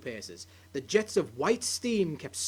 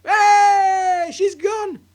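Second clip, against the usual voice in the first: high, loud triumphant shout; gleeful cry; 14 semitones higher, 13 dB louder, much slower than usual. Acted. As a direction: shout it out in delight. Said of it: "cried Mister Cranky".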